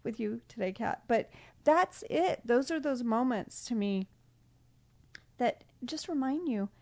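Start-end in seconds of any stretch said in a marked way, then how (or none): 0.0-4.1 real teary laugh, a little louder; embarrassed laugh through emotion on a podcast; laughing through tears. Real, not acted. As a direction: laugh it off while choked up. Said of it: "did not think I'd get emotional".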